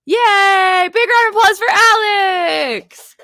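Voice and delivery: high, loud cheering announcement; excited cheer. Real, not acted. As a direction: cheer the crowd on. Cheering artist at auction.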